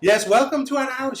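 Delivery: high, loud hostess voice; welcoming lady of the house. Acted. Lady to guests coming inside, effusive welcome. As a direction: welcome them in with a big high voice.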